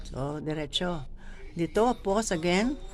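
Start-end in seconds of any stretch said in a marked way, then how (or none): none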